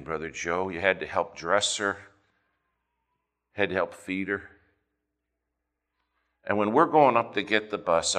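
usual speaking voice

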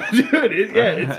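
high, loud talk through laughter; laughing. Real, not acted. Friend cracking up mid-nightmare story, helpless laughing. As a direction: crack up while trying to answer. Laughing at another speaker's remark.

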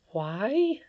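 put-on a little girl marvelling to herself; young Irene. A narrator's reading. Irene thinking about the old lady, light child voice.

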